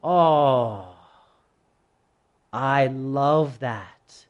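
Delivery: low, drawn-out tender approval; approving God. Acted. God looks at that humble attitude, approvingly. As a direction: savour it slowly and low. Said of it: "God looks at that attitude and he says".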